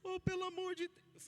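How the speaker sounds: high-pitched, wailing plea; mock tearful plea. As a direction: beg like you're bawling, hammed up. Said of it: "eu falei desse jeito".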